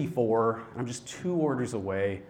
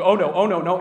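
mock panic; 5 semitones higher, 9 dB louder than usual. Cook panics at crying customer.

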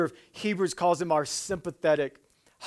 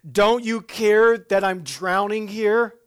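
desperate plea; 6 semitones higher, 9 dB louder, slower than usual. Retold or imitated. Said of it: "this is my response to God".

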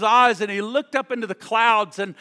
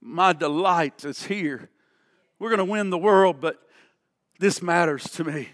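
voice of God; 3 semitones lower than usual. Acted. Voicing God tenderly, soft and low.

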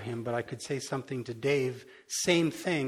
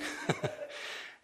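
soft laugh; 7 dB quieter than usual. Real, not acted. Laughing at own request.

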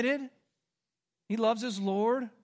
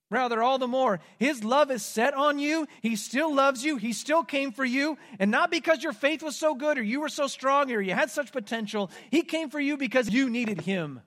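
higher, louder preaching build; impassioned preaching; 7 semitones higher, 6 dB louder than usual. Acted. Preacher urging the congregation, impassioned and louder. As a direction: preach it with rising fervor.

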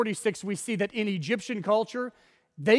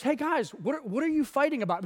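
light, higher friendly voice of a would-be peacemaker; breezy, falsely casual peacemaker; mock peacemaker; 5 semitones higher than usual. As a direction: Moses stepping between two fighting men, lightly voiced peacemaker. Moses acting peacemaker in retold scene.

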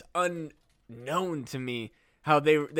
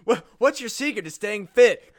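ordinary talk

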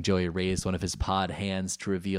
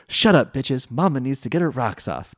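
loud, higher snapping comeback; sharp retort; 6 semitones higher, 7 dB louder than usual. A narrator's reading. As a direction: snap back at them, loud. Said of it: "Taya shot back sharply".

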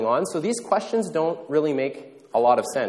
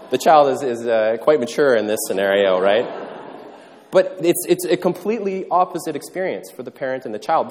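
ordinary delivery

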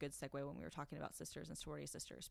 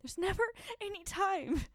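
mock despair; 8 dB louder than usual. Mock complaint about no time.

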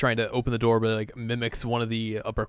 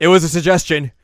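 raised, higher indignant voice; loud protest; 6 semitones higher, 13 dB louder than usual. Retold or imitated. Azusa defending the tea idea, indignant. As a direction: blurt it out defensively. Acted outburst, first take.

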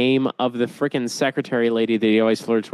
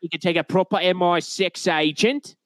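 mock British accent; 5 semitones higher than usual. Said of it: "mocking British agent".

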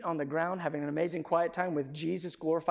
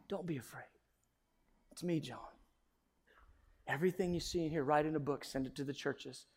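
very soft, tender voice; calm a frightened friend quietly; hushed, soothing words with space between them; gentle reassurance; 3 semitones lower, 9 dB quieter, faster than usual. Acted. Voices Jesus reassuring fallen John.

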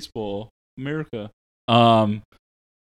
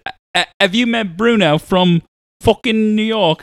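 put-on New York accent; 10 semitones higher, 7 dB louder than usual. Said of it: "the English accent to the New York".

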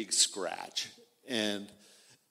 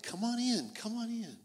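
welcoming father; 11 semitones higher than usual. Acted. God with arms open wide.

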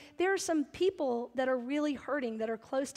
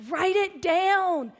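slow, loud, pressing; emphatic command; 4 semitones higher, 6 dB louder, much slower than usual. Acted. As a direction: hammer home each word. Preacher repeats the command.

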